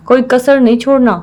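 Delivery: usual speaking voice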